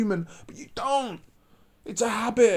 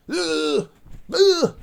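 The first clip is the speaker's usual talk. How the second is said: high disgusted ugh, louder on the second; disgusted groan; 9 semitones higher, 3 dB louder than usual. Real, not acted. Vlogger groans in disgust at partners who play victim. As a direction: groan in disgust at their excuses.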